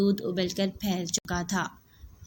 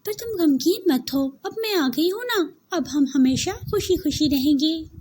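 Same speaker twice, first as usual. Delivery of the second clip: put-on bright, higher young woman's voice, cheerful; sweet new bride reassuring her; 8 semitones higher, 4 dB louder than usual. Saira comforts her sister-in-law. (खुशमिज़ाज नई दुल्हन की आवाज़)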